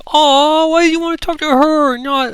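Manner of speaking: put-on very high, whiny children's groan; disappointed kids. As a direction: whine like left-out children. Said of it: "chorus of disappointment".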